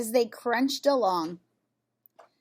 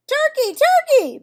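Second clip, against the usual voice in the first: much higher, sing-song coaxing call; sweetest voice; 10 dB louder than usual. A narrator's reading. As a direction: coax the turkey out sweetly, up high. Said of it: "in his sweetest voice".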